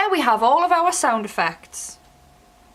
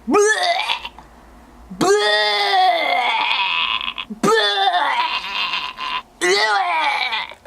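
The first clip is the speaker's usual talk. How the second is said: gag it out like you're throwing up; vomit noise; 5 semitones higher, much slower than usual. Shown as a demonstration. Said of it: "now we need to make a vomit noise".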